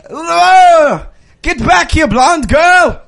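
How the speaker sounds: attempted Italian accent. Shop owner calls back a thief, mock-Italian accent. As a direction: put on a bad Italian accent. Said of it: "I'm gonna be an Italian shop owner".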